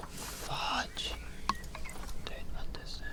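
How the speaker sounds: hushed, breathy-voiceless whisper; whispered to self. Real, not acted. Gamer stuck on a building, whispering to themself. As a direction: whisper it under your breath.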